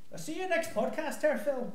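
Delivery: high, soft Irish farewell; receding stage-Irish goodbye; Irish accent. Acted. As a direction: sign off in a faint, high Irish lilt. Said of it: "Your Irish accent".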